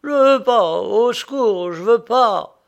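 loud, high panicked screaming; terrified cry. A narrator's reading. Prisoner fighting the guards before execution, panicked screaming. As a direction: scream for your life, refusing. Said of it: "et il cria".

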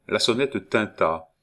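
usual speaking voice

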